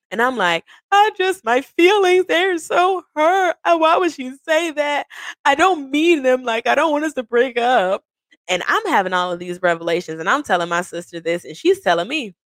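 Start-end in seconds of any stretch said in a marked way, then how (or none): 0.9-8.0 high, whiny, put-on hurt voice; hurt whimper. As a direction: play the wounded one, half joking. Retelling her hurt feelings.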